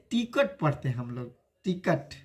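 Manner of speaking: "टिकट" as ti-kat, second vowel a schwa; "टिकट" with an uh in the last syllable; common mispronunciation shown. Shown as a demonstration. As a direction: demonstrate the everyday "टिकट" ending in -kat. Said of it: "क्या गलत पढ़ते हैं लोग".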